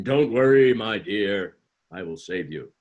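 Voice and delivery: deep, melodious voice from above; bass voice of God. Acted. God answering the dying woman, deep bass reassurance. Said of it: "it's a bass voice".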